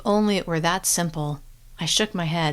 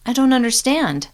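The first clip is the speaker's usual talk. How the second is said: higher and louder, stunned; shocked confusion; 6 semitones higher, 6 dB louder than usual. A narrator's reading. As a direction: blurt it out in disbelief.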